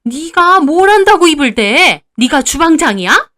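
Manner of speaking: loud, high, drawn-out angry scolding; fierce mother-in-law. A narrator's reading. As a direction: pounce on her like a wildcat. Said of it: "살쾡이처럼 저를 노려보시더니". (삿대질하듯 호통치는 시어머니)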